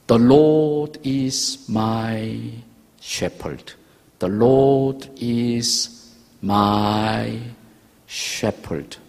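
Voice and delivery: "The Lord is my shepherd" drawn out very slowly, word by word; slow recitation. Shown as a demonstration. Father teaching his sick son the psalm, very slow. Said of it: "영어로 하면 다섯 단어예요".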